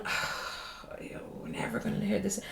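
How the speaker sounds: soft, unvoiced grumbling whisper; muttered whisper. Girl at school muttering back at the boys, whispered. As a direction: whisper it under your breath, resigned. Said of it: "And you're going".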